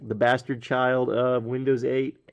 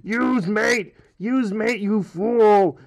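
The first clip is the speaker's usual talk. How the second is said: higher, mock-outraged cry of "use Mate"; mocking angry fan; 5 semitones higher, slower than usual. Upset Mate fan yelling at Gnome users, hammed-up higher outrage. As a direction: ham up an indignant Mate fan's plea. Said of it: "and they go".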